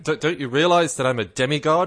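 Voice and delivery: hammed-up self-important protest; mock indignation. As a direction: play the affronted star athlete. Mocking an indignant footballer's boast.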